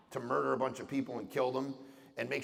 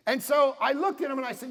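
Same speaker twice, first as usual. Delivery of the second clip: raised preaching voice; 10 semitones higher, 6 dB louder than usual. Real, not acted. Preacher raising voice mid-story.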